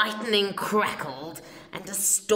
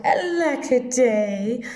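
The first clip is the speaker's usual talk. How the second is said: high, loud wail of woe; hammed-up sobbing lament; crying; 4 semitones higher, 7 dB louder than usual. A narrator's reading. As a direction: cry out like a heartbroken child. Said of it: "And he cried".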